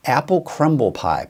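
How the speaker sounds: ordinary talk, nothing marked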